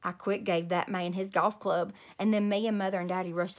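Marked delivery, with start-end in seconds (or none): none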